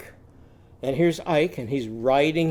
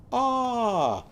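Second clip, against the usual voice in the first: much higher falling ah; high ostrich ah; 9 semitones higher than usual. Shown as a demonstration. Teacher voicing Ollie Ostrich's sound. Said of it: "what's Ollie Ostrich's sound".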